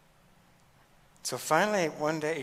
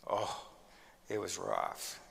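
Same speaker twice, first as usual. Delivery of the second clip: ordinary delivery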